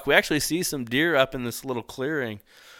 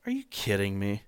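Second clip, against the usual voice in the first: retold disbelief; 4 semitones lower, 10 dB quieter than usual. Retold disbelief at intruding hunter.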